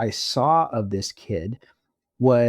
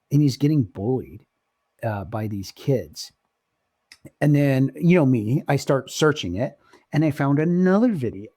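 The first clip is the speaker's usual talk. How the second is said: normal talking voice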